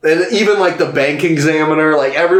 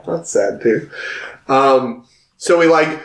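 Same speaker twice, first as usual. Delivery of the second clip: normal talking voice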